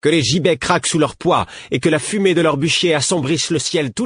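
high, loud, fiery cry for vengeance; furious with fire. Acted. Rebel leader demanding blood vengeance from the queen, furious. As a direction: hammer each eye-for-an-eye with rage. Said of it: "déclara Mercureuil avec feu".

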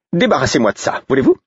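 busy maid ordering the men about; brusque maid. Acted. Brodette enters, brusque.

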